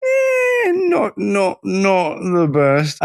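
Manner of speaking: high, drawn-out hedging; stretched, squirming understatement; reluctant verdict. Real, not acted. Hedging on the film's accents.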